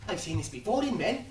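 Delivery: usual speaking voice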